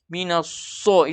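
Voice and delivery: slow "Minas so" with lips pushed out; wrong pouted so. Shown as a demonstration. Teacher shows a misplaced articulation, pouted so. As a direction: round the SO in "Minas so". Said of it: "membaca dengan memonyongkan bibir".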